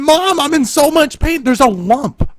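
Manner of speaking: mock his own kid self complaining; hammed-up hurt boy; whining in pain. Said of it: "And I'm going".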